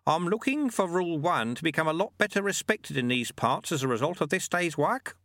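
high, measured voice of a canny monk; Lutze, wily old monk. Acted.

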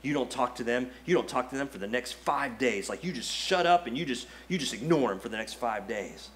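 low, coaxing devil urging silence; tempting devil voice. Retold or imitated. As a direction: coax them to sulk, like the devil. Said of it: "he's like".

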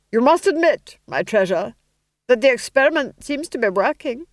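high, loud duchess voice. Acted. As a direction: purr a smug point at her husband. Duchess to the duke.

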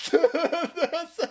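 high giggling word; laughing. Real, not acted. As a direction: laugh through it. Laughing mid bit.

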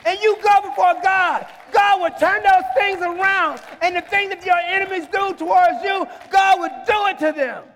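impassioned, sustained proclamation; full-force preaching. Real, not acted. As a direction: drive each phrase home to the congregation.

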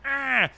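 short high-pitched mock shriek; high, hammed-up "ah"; mock hysterical scream. Retold hysterical knife-wielding girl screams.

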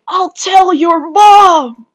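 high, slow whiny shout; childish shout. Pretend. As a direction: yell it like a tattling kid. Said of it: "other bullshit like".

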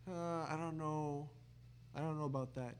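mild hedging doubt; doubtful reaction. Acted. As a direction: voice an unconvinced friend, fairly softly. Imagined doubtful friends react.

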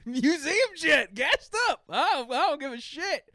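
high, loud hyped-up voice, slowed at first; mock hyped voice. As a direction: mimic a pumped-up bro. Acted quote, loud high voice.

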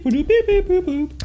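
high robotic beeps; beeping noises. Shown as a demonstration. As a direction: make high little beep sounds. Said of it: "a bunch of beep".

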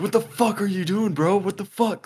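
high-pitched mock yell at the attacker; retold angry yell. Confronting the man grabbing the lady, high angry yell.

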